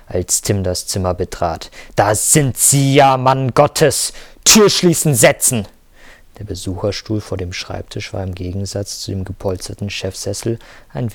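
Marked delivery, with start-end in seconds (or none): 1.9-5.7 angry shouting. Acted. Boss barks orders at employee.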